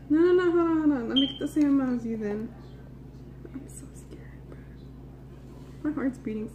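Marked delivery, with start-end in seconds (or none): 3.5-4.6 scared whisper. Real, not acted. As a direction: whisper it, frightened. Whispered fear before eating.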